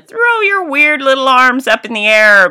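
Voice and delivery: loud high announcer yell; playful loud hype shout. Retold or imitated. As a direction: shout it to the crowd. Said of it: "there I am yelling".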